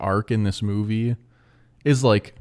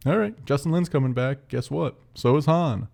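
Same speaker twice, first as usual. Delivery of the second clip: franchise announcing; 6 semitones higher than usual. Retold or imitated. Voicing the franchise's casual announcement.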